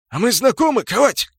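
high, loud wary challenge; startled orc. A narrator's reading. Orc rogue challenges the smith greeting him, startled.

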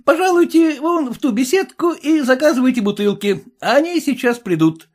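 high, dashing exclamation; exclaiming. Acted. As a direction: announce it with a dashing flourish. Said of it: "воскликнул он".

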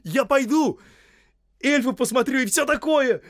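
very high, loud jubilation; squealing delight; excited crying out. Acted. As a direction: shout it like an overjoyed servant. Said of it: "вскричал Сэм".

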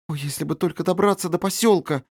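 put-on high, imploring little girl; pleading. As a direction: beg the sky for rescue. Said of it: "говорила она с мольбою".

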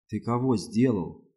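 usual speaking voice

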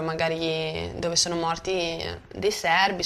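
ordinary talk, nothing marked

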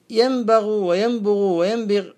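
ينبغ وينبغ وينبغ said slowly as yanbaghu, yanbughu, yanbigh; vary the vowel after b in ينبغ: a, u, i; three vowel forms. Shown as a demonstration. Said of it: "بالفتح والكسر والضم".

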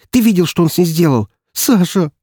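high-pitched, outraged woman; agitated, indignant appeal; shaking with anger. A narrator's reading. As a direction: demand a reaction, furious and incredulous. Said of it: "Её аж трясло от злости".

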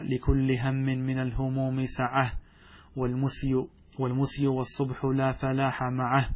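slow, measured reading of the verse; poem recitation. Acted. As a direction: recite it slowly and gravely.

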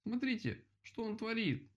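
high, lamenting outcry; wailing complaint. A narrator's reading. Rabbit hit by his own cone, high wailing complaint. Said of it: "запричитал кролик".

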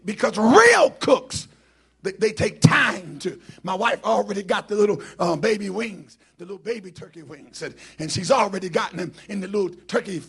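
very loud pulpit voice; full-force preaching. Real, not acted. Preacher explaining real cooks to congregation, very loud. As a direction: belt it out to the back of the church.

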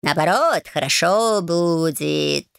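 slow purring cat voice. Acted. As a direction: drawl it like a smug old cat. Cat Bayun speaks.

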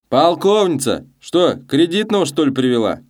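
loud, high hail across a tavern; calling out. A narrator's reading. Hailing woman across the den.

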